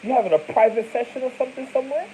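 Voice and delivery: put-on high, fast teasing boyfriend; mock boyfriend. Imitating the boyfriend teasing his girlfriend.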